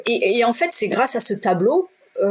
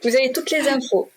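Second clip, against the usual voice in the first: raised, high, bossy retold order to the universe; 8 semitones higher than usual. Telling the universe to sort it out, a raised bossy order. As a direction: hand it over and snap your fingers.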